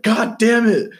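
high, loud raging yell; retold scream. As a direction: scream it like a furious gamer kid. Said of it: "And then he screams".

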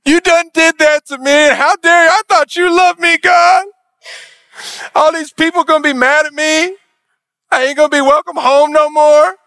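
high, loud, whining self-pity; whiny victim. Retold or imitated. Preacher mocking Cain whining to God, high whiny wail. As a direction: wail like a wronged child. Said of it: "Cain is now playing the victim".

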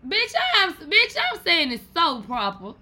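high, loud indignant claim; loud protest. Real, not acted. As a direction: insist loudly you said it right. Loud protest while imitating.

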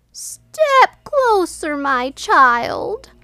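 high, slow, creepy fake grandma; wolf voice. A narrator's reading. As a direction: draw it out like a wolf faking grandma. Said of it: "said the wicked wolf".

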